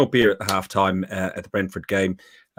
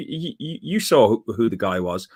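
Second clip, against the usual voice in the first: ordinary talk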